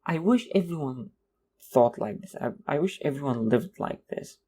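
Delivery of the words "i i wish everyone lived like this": normal talking voice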